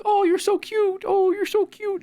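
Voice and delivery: put-on high, slow cooing baby talk; mock doting adult. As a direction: coo at a toddler. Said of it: "they're like".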